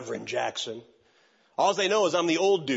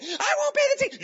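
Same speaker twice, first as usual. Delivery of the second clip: high, loud mock shout; hammed-up furious woman; shrill defiant yell; 4 dB louder, faster than usual. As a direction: scream back at the judge.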